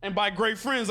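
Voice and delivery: normal talking voice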